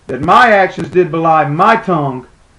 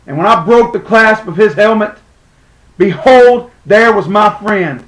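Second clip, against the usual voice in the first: raised, ringing dramatic cry; anguished revelation; 4 semitones higher, 5 dB louder than usual. Acted. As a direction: burst out with the terrible discovery. Discovers slain man is friend.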